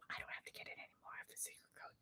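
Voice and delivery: secret whisper to viewers. Real, not acted. Vlogger whispering her secret code to viewers.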